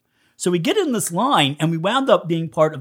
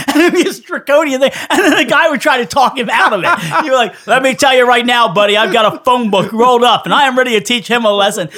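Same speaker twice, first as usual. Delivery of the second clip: loud, high mock fury; hammed-up angry father shouting down the phone; mock furious father; 6 semitones higher, 6 dB louder than usual. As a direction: ham up the outraged dad threatening punishment. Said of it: "Pretend to be furious".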